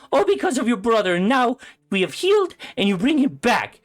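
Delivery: grieving mother screaming at her son Despero; furious screaming mother. Acted. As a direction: scream at him through grief. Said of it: "she goes".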